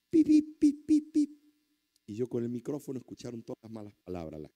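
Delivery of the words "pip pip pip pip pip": loud, high-pitched vocal bleeps; imitated censor beeps. Wife's swearing censored for the congregation, loud mimicked beeps.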